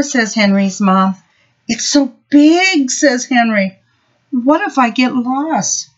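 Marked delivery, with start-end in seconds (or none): 1.7-5.9 put-on nervous little boy. Nervous child at school door.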